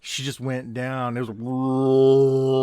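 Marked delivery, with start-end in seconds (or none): 1.3-2.6 long, loud drawn-out collapse noise; slow, booming sound effect; imitated falling body. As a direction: swell a long rolling fall sound. Imitating her collapse to the floor.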